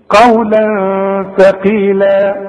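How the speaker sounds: chanted melodic recitation with nasal tanween; tajweed recitation. Shown as a demonstration. Teacher demonstrating tanween before ثاء, melodic recitation. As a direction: chant قولا ثقيلا, hiding the tanween into the ثاء with ghunna. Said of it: "التنوين لابد إنه يكون إيه".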